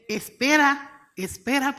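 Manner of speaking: loud, slow, drawn-out command; retold divine command, loud and stretched; emphatic urging. God's command to wait, retold.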